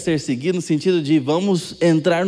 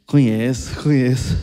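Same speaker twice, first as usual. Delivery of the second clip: mock knowing reply; 5 semitones lower than usual.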